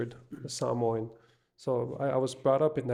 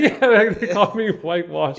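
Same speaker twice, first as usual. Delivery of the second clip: higher and louder, laughing through it; 6 semitones higher, 11 dB louder than usual. Real, not acted. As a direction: repeat it while cracking up. Still laughing, repeating the nickname.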